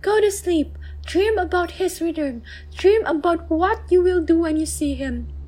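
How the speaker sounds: high, gentle mother's voice; soothing mother. Acted. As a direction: hush a frightened child. Mother lulls son.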